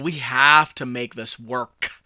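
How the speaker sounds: slow line, kicking the K that closes WORK; emphasized final K. Retold or imitated. Tense husband talking to wife, WORK ending on a punched K. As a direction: WORK with a hard, released K at the end. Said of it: "And I emphasized the K".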